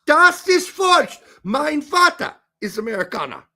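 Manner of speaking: emphatic German in an American accent. Real, not acted. Host insisting his father is American, American-accented German. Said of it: "my horrible Deutsch accent".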